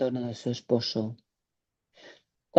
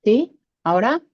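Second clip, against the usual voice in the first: louder and higher to be heard; speaking up; 6 semitones higher, 5 dB louder than usual. Real, not acted. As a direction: raise the voice so the mic catches it. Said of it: "Un poco mejor".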